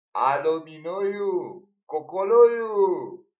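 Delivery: loud, high, drawn-out call down the line. Retold or imitated. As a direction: shout the names down the phone. Calling out on phone.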